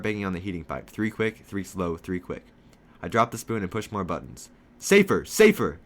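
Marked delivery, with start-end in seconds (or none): 4.8-5.8 high, loud, repeated urgent calls; yelling. Acted. George warning friend of man in lobby, yelling. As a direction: shout the name, desperate to be heard. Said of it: "I yell".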